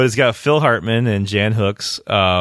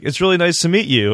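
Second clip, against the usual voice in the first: higher, earnest greeting; a hint of a sincere jock; sincere guy; 8 semitones higher than usual. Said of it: "he's like".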